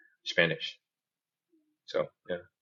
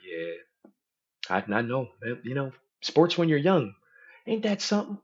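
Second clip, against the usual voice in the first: sports bro voice; 6 semitones higher than usual. Retold or imitated. Mimicking sports small talk.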